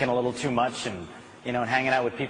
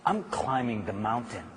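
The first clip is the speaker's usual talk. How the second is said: quiet resolve; 4 semitones lower, 5 dB quieter than usual. Retold or imitated. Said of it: "she said".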